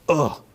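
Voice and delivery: short, high exasperated ugh; frustrated groan. Acted. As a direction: groan sharply at your own blindness. Acts frustration at missed opportunities.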